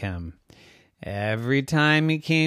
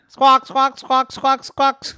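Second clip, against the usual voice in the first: high, loud bird calls; seagull squawks; 13 semitones higher, 7 dB louder, slower than usual. Acted. Seagulls calling out at sea, squawking. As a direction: squawk sharply like a flock of gulls. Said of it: "there were the seagulls, and they would say".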